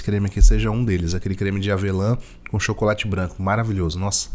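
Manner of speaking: chewing while talking; eating between words; mouth full. Real, not acted. Seen 2: Holds panettone piece at lips, eating through all labelled frames.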